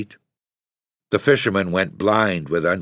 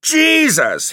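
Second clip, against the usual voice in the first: a furious cry, far higher and louder; mad cry; 7 dB louder than usual. Acted. Father maddened by the boys, furious cry. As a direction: explode at their stupidity. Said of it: "he cried".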